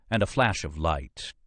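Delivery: usual speaking voice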